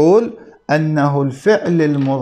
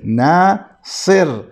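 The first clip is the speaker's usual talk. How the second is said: ناصر stretched out slowly, syllable by syllable; slow demo of the word; much slower than usual. Shown as a demonstration. Teacher to students, marking each letter, slowly. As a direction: draw out ناصر so the vowels show. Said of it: "الصاد متحرك".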